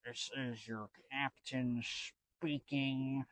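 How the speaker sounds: slow, soft, detached pilot voice over the intercom; lazy PA announcement, slowed down and hushed; unbothered captain announcement. Retold or imitated. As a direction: shrug it off like a bored captain. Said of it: "kinda does it in this manner".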